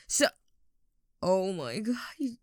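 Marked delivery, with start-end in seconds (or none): none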